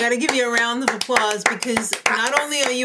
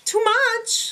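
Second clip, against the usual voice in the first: much higher, playful outburst; theatrical exclamation; 12 semitones higher, 4 dB louder than usual. Pretend. Weighing the garish look for elimination, hammed-up high exclamation. Quotes judges' verdict theatrically.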